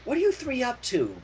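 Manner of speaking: lightly voiced tough pony, pressing the fillies; mild demanding tomboy. Rainbow Dash questioning the fillies, lightly voiced and demanding. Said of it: "Rainbow demanded".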